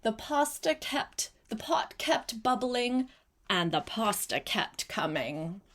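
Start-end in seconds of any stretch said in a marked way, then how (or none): none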